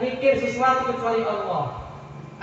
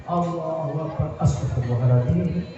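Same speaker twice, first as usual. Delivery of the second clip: distracted worshipper; 4 semitones lower, 4 dB louder than usual. Worshipper remembering the kitchen stove mid-prayer, increasingly loud low voice. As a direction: start the prayer, then blurt the worry loudly.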